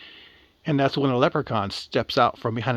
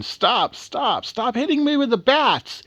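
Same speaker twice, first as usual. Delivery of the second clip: high-pitched acted cry of pain; screaming in pain; 11 semitones higher than usual. Beaten leprechaun begging the girls to stop, acted scream. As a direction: plead in a hammed-up high yelp. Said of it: "The leprechaun's screaming in pain".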